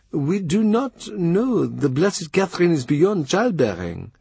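high, careful foreign envoy voice; Chapuis voice. A narrator's reading. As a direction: Chapuis speaking with courtly hesitation. Ambassador voice again.

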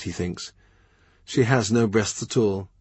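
ordinary delivery, nothing marked

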